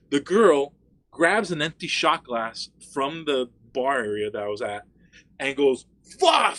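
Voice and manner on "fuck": loud, high burst; acted-out furious outburst; mock angry yell. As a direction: let out a throwing shout. Acts out girl flinging the glass.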